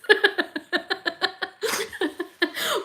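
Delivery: long, high-pitched giggle; rapid run of high giggles; amused laughter. Real, not acted. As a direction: laugh helplessly at the remark. Laughs at Trax regretting the mention.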